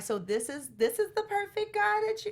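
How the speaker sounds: high-pitched judging onlooker; mock incredulous. Onlookers judging her choice of man, mock incredulous. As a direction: imitate disbelieving onlookers, pitch jumping up.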